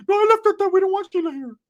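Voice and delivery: very high-pitched cousin voice; exaggerated squeaky imitation; Ricky. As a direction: mimic him way up high. Ricky answers about Sheila.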